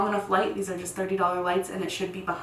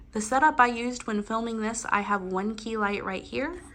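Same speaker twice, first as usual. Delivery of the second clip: ordinary talk, nothing marked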